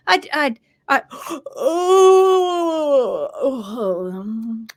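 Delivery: hammed-up flustered cell; panicked gasp. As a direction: gasp as it falls apart. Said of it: "papillae up at the top's going".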